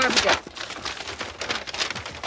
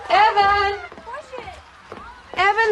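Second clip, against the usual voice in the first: worried raised call; 10 semitones higher, much slower than usual. Acted. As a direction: shout for the boy from another room. Shouting for son about cat.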